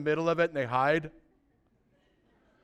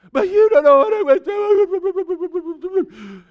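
put-on much higher, louder, slow wailing kid voice; whiny crying child; 11 semitones higher, 9 dB louder, much slower than usual. Lost child wailing back at mommy in the store, much higher and slow. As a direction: wail it like a sobbing toddler.

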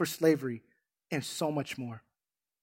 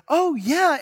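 higher, louder, thrilled yes; eager yes; 11 semitones higher, 6 dB louder than usual. Retold or imitated. Boy eagerly accepting grandpa's fishing trip, excited. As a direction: jump at the offer like a happy kid. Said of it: "He goes".

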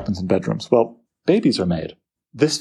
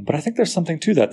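ordinary talk

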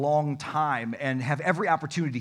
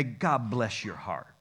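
Southern sweetness; 6 semitones lower, 4 dB quieter, slower than usual. Retold or imitated. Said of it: "'Cause Southern people, they're so sly".

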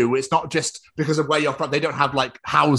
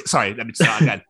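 normal talking voice

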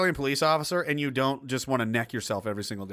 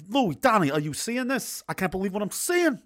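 ordinary talk

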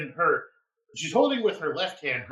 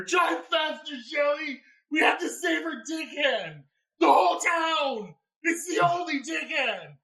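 mock yelling; 12 semitones higher than usual. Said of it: "I'm just yelling up at my partner".

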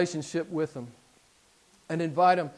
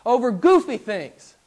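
rising, louder, mocking warning; sarcastic retelling, louder and higher at the end; 5 semitones higher, 6 dB louder than usual. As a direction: build the sarcasm until it's nearly shouted. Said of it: "he said sarcastically".

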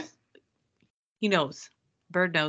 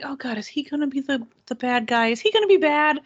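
mock worried; 7 semitones higher, 6 dB louder than usual. Voicing her own worry watching.

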